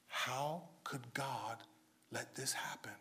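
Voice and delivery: whispered, low and slow, as a quiet lament; hushed question. Retold or imitated. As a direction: breathe the question almost without voice. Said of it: "the first question you hear is".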